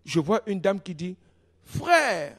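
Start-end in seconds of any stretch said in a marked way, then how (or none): 1.6-2.3 put-on high-pitched French with an American accent; American-accented call, pitched up; American English accent. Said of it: "avec un accent anglais américain".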